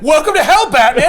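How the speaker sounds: high, loud mock-cheery demon; gleeful demon host. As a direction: welcome him like a delighted demon. Demon greeting Batman.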